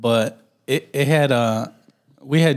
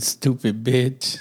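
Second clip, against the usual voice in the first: slow, stretched insult; cartoon insult voice; slower than usual. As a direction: drag out the words playfully. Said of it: "we could call each other stupid".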